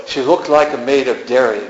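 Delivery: usual speaking voice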